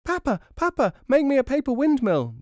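put-on very high, loud little child; demanding child. A narrator's reading. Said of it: "demanded a fifth".

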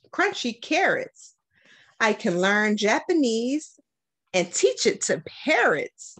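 put-on lively, sing-song read-aloud for kids; bright picture-book reading voice; reading to children. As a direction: read it like story time for young kids. Principal reads picture book aloud.

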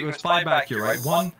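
usual speaking voice

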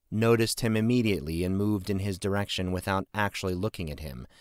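usual speaking voice

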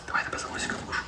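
soft playful whisper; whisper to daughter. Real, not acted. Father whispering a playful threat to his small daughter, hushed. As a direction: breathe it into her ear, barely voiced.

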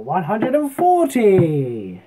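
high, loud, slow drawn-out caller's roar; referee voice. Shown as a demonstration. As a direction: bellow the big score like a darts referee. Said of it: "get our referee voice on".

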